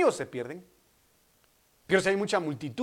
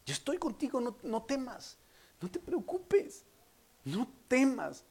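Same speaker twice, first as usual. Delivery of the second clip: higher, gentle reassurance; tender soothing; 6 semitones higher than usual. Acted. Preacher voices a father soothing.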